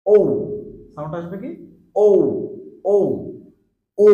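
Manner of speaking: ও said as a rounded gliding o; diphthong demo. Shown as a demonstration. Teacher drilling ও for students. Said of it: "same ভাবে".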